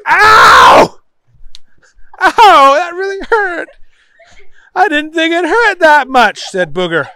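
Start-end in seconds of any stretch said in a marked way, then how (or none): 0.1-1.0 loud acted "ow"; yelp of pain. A narrator's reading. Booger after poking his eye, loud hammed-up yelp. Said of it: "he poked himself in the eye". 2.2-6.5 high, loud wail of pain; hammed-up crying. A narrator's reading. Booger poked his own eye, high theatrical wailing. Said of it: "He tried to cry".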